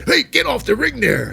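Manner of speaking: high, loud mock referee shout; referee yelling. Referee ordering Bianca off the apron, loud high yell. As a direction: bark it like a ref ordering her off.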